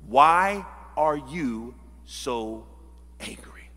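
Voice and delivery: very slow, low, grave question; God questioning. Acted. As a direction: ask it word by word, dropping to a hush. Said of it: "But God asked this question".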